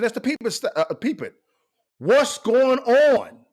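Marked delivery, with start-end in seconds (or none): 2.0-3.4 very slow, stretched question; drawn-out refrain. Real, not acted. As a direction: draw every word out, pointed. Rhetorical refrain in advice.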